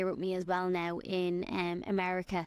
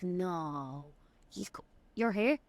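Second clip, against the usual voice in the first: retold disbelief; slower than usual. Americans react to an Irish visitor, retold disbelief. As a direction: act out baffled locals hearing where you're from. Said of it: "they're just so confused".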